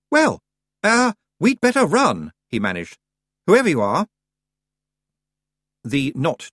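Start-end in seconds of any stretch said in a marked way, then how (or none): 0.1-2.3 high, loud nervous stammer; flustered urge to flee; nervous young voice. Acted. Nervous boy facing monster. 3.5-4.1 put-on nervous young voice. Nervous boy facing monster.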